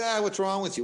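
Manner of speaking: mock jeering. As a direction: lightly mimic someone picking on her. Onlookers jeering at a struggling woman.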